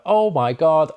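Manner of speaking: retelling the moment the offer email arrived, excited. Retold or imitated. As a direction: blurt it out thrilled. Got the job offer.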